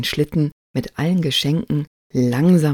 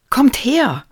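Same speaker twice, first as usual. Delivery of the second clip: put-on high, raised girl's call; 8 semitones higher, 5 dB louder than usual. A narrator's reading. Marie summons her friends to her find, calling out. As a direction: call the others over. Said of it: "rief sie".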